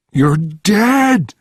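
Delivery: high, loud scream of disbelief; terrified, shrieking accusation; panicked scream. A narrator's reading. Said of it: "screaming, his eyes wide in panic".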